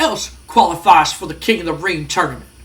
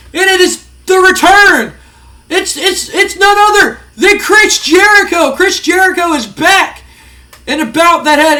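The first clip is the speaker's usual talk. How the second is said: excited play-by-play; 7 semitones higher, 5 dB louder than usual. Real, not acted. Excited play-by-play of the surprise return.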